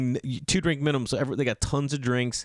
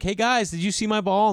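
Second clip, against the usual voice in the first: calling out; 10 semitones higher than usual. Retold or imitated. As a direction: hail the group from a distance. Calling out to golfers.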